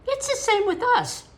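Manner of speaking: put-on high, loud and eager boy. A narrator's reading. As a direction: jump in eagerly. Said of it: "Hecate argued eagerly".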